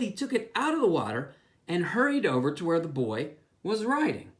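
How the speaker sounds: ordinary delivery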